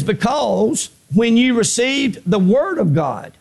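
slow, weighty scripture reading; deliberate preacher's reading. Real, not acted. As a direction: let each word of the verse land. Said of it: "I'm gonna read it slow".

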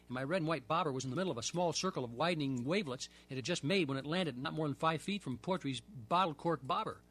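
ordinary delivery

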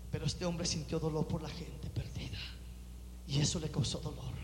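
whispered, low and tender; hushed. Acted. Preacher softly telling of Nehemiah's pain, whispered. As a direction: drop to a whisper to draw them in.

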